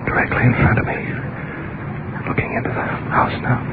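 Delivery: hushed, voiceless running report; whispered on-the-scene commentary; real whisper. Real, not acted. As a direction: whisper what you see without being heard. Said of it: "We can't speak over just a whisper".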